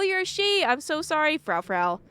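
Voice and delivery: ordinary talk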